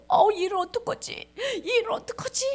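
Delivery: high, whimpering mock despair; tearful dismay. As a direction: fret as if about to cry. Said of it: "하만이 울상이 돼서". (울상이 되어 걱정하는 연기)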